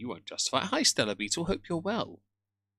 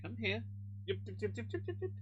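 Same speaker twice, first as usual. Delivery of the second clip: talking to the dog; 11 semitones higher, 9 dB quieter than usual. Real, not acted. Owner coaxing his dog onto his lap, high soft baby talk. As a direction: coo at the pet in a high hushed voice.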